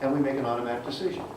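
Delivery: ordinary talk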